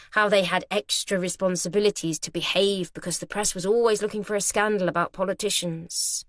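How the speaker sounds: ordinary delivery, nothing marked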